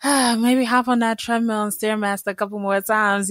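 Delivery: high, airy mock-lazy self; lazy inner voice. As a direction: play your lazy self shrugging it off. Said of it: "I'm like".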